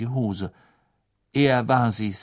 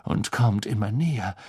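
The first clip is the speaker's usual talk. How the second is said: ominous whisper; 3 dB quieter than usual. Acted. Trelawney foretelling to Harry.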